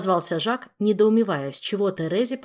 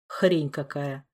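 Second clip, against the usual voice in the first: frowning grumble; 5 semitones lower, much slower than usual. Acted. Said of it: "нахмурился Кантор".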